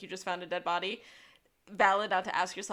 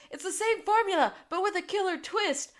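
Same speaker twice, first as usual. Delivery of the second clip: high, raised, showy delivery; acted excited reply; 11 semitones higher, 5 dB louder than usual. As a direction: announce it in a high hammed-up voice. Acts out Sean shouting his reply.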